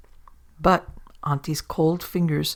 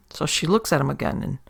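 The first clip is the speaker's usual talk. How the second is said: plain reading voice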